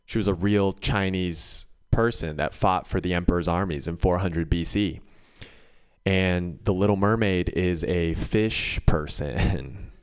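ordinary talk

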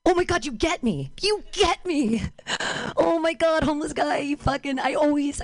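high, thrilled gushing; gushing delight. Retold or imitated. Comic answering the homeless man's compliment, gushing high. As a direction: swoon at being understood.

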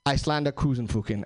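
low, put-on Nordic app name; lightly voiced woman, Nordic accent; mock Scandinavian. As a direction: say it like a made-up Icelandic word. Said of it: "she said".

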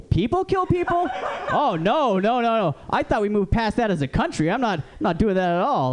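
high-pitched comic disbelief; mock outrage. Homeless gun shopper learns people kill people, mock outrage. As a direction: recoil in exaggerated, high-voiced shock. Shocked reply in a gun joke.

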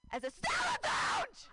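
high shrieking yell; shrieking shout. Acted. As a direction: scream the punchline high. Punchline outburst.

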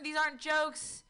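ordinary talk

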